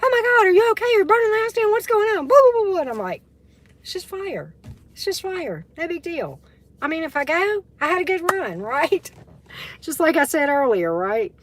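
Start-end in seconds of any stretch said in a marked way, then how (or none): 0.0-2.8 panicked friend. Retold or imitated. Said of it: "he's sitting there going". 3.8-9.1 higher, easy and unbothered; cool and collected. Retold or imitated. Reassuring her panicked friend on the phone, nonchalant. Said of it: "real cool, calm, and collected".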